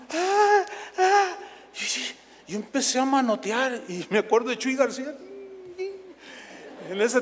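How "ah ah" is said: high swooning ah ah; mock fan moans. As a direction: imitate fans sighing in rapture. Imitating fans moaning.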